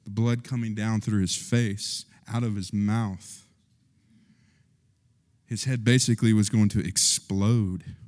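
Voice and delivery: usual speaking voice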